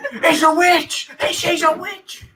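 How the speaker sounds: high, slow mob shriek; angry mob shout. Pretend. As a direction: screech it like a peasant mob. Monty Python mob quote.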